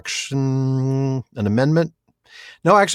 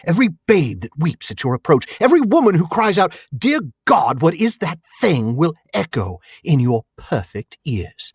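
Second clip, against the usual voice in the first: Cary Elwes; 5 semitones higher than usual. Acted. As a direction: build a theatrical taunt, savoring each threat. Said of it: "a masterful Cary Elwes impression".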